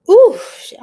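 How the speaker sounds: loud, high startled "ooh"; sudden yelp. Real, not acted. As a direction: blurt it out as it hurts. Sudden pain sitting too long.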